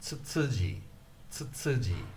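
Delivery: Tsutsuji with ts sounds; Japanese name. Shown as a demonstration. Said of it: "family name".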